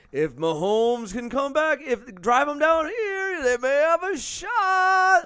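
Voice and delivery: slow, stretched mock hype, rising to the last word; Romo. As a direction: build to a drawn-out, high-pitched cry. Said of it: "Romo tried. He's like".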